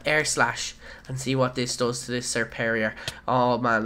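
ordinary delivery

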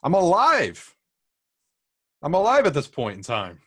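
loud, excited I'm alive; shouting with glee. Pretend. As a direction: proclaim it to the room. Joking shout about being born.

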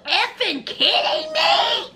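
mock furious mother; outraged yell. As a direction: shout it in disbelief. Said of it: "She said".

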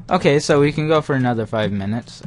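fast, loud return to the stream; upbeat announcement. Real, not acted. Streamer returning to viewers, loud quick upbeat update. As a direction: bounce back in with energy. Back from checking the time.

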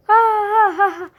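loud high burst of laughter; laughing. Real, not acted. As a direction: crack up at your own silly voice. Laughing after silly goodbye.

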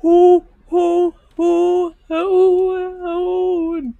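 loud startled calling out. Real, not acted. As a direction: call out loud and spooked. Spooked by the game's noises.

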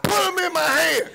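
squealing demand. Acted. Blind man shrieking at his friends for the bullets. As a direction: howl at them to hand the bullets over.

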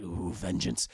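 very low, sinister voice; Johnny swearing revenge on the bully; menacing vengeance. Retold or imitated. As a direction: growl it like a villain.

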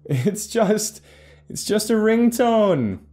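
high, loud and halting, amused; breaking off, then restarting in high-pitched amusement; talking through laughter. Acted. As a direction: pause between words as if cracking up. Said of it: "pausing in between words because of his laughter".

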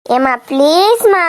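slow, drawn-out coaxing; low, cajoling whine to mother; wheedling plea. Acted. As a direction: stretch out the please and wheedle. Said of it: "என்ன கொஞ்சிட்டு அலையுதியா?".

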